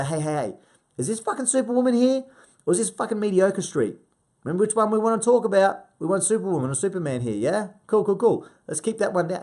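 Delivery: high, loud playful call-out; rousing challenge. Acted. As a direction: pull the client up on excuses, full of energy. Acted coach challenging client's excuses.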